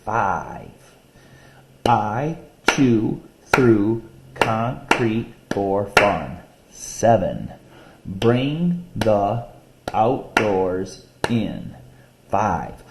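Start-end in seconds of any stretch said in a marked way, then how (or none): 1.9-6.4 very slow, each syllable spaced out; slow poem reading by syllables; syllable-counting reading. Shown as a demonstration. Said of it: "Listen to the syllables". 8.1-11.8 very slow, each syllable spaced out; slow poem reading by syllables; syllable-counting reading. Shown as a demonstration. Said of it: "Listen to the syllables".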